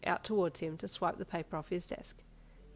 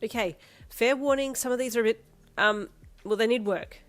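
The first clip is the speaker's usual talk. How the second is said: louder, higher, hesitant; nervous grin; 4 semitones higher, 7 dB louder than usual. Acted. Girlfriend warning boyfriend about run-down houses, nervous and louder. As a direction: hedge it nervously. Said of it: "with a nervous grin".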